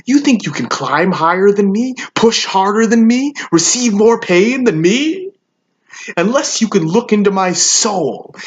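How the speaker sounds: escalating, high-pitched defiant questions; fierce rhetorical taunts, rising at the ends; taunting challenge. Acted. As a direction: build each question as a dare.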